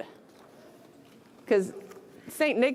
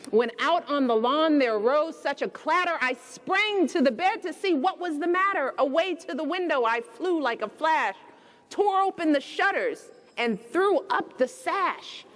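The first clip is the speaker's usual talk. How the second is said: gentle read-aloud storytelling; bedtime story reading; 5 semitones higher than usual. A narrator's reading. Reading The Night Before Christmas aloud to children, storybook voice.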